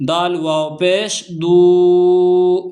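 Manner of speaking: دو held long with the u drawn out; stretched syllable. Shown as a demonstration. Teacher stretching the long u in دو for students. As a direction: stretch the vowel in دو slowly. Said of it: "تو اس کو کھینچ کر پڑھتے ہیں".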